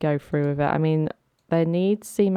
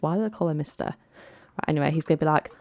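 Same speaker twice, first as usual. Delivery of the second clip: ordinary talk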